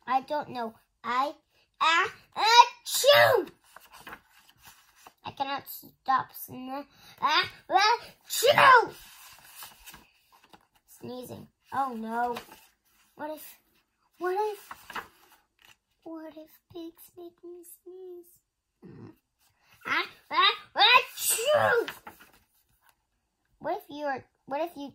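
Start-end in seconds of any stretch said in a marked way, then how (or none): 1.8-3.5 slow build, then a loud high achoo; drawn-out sneeze. Acted. Gerald unable to stop sneezing, slow loud sneeze. 7.2-8.9 slow build, then a loud high achoo; drawn-out sneeze. Acted. Gerald unable to stop sneezing, slow loud sneeze. 19.9-21.9 loud high sneeze with a laugh in it; giggly sneeze. Acted. Gerald sneezing near Piggy, laughing sneeze.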